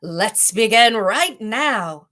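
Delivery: slow, loud roaring chorus of tigers. Acted. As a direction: roar it out slowly like hungry tigers. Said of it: "together in a loud roar".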